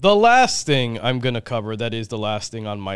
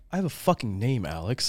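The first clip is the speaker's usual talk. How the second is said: softer, deadpan retelling; serious and somber; 3 semitones lower, 6 dB quieter than usual. Retold or imitated. Friend complaining to Alex about not being named, mock-somber. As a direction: imitate grave, understated indignation. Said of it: "with, like, the most serious but, like, somber eyes".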